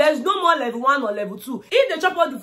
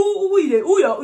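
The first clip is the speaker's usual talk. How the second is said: usual speaking voice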